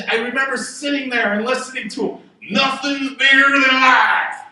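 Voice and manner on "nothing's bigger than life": slow, louder booming mentor, "bigger" leaned on; Sid. Retold or imitated. Recalling his mentor Sid at a seminar, slow and loud. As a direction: boom it out like a revered old teacher. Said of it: "I can hear him".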